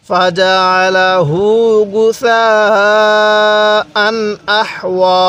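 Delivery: গুসাআন held long, আহওয়া clear without nasal; clear tanween. Shown as a demonstration. Teacher showing izhar in the verse, slow. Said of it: "কেন গুণনা করি নাই?".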